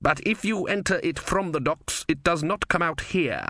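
musing. A narrator's reading. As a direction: puzzle it out aloud. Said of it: "Vorbis mused".

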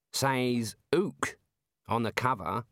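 mildly strained, careful reading aloud; slightly tense, halting remark; rather strained voice. A narrator's reading. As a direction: hold back a touch of disbelief. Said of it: "in a rather strained voice".

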